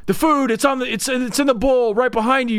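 high-pitched, loud imitated pleading; frantic mock shout. Man at the door directing others to the bowl, frantic mock shout. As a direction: insist frantically, as if acting out the scene. Acting out movie character's frantic order.

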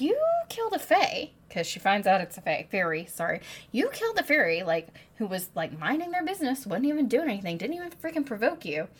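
high, loud disbelief that climbs to a near shriek; a mock-furious beast scolding Feyre; incredulous beast rant. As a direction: tear into her, outraged she dares ask a favour.

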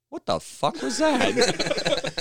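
high, drawn-out mock disbelief; bewildered outburst. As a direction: imitate a baffled prank victim, stretching the words. Imitating the bewildered prank victim.

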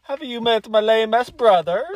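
high, loud cartoon Italian accent; mock Italian accent. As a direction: join in with a hammed-up Italian voice. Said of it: "racist Italian accent ever".

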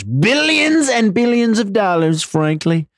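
high, slow hammed-up relish; mock greedy gloating. Wife savouring Jeff's billions, mock greedy gloating.

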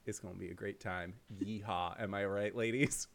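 put-on low, quiet old prospector voice. Shown as a demonstration. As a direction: drop low into a grizzled gold miner. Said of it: "watch me be an old prospector".

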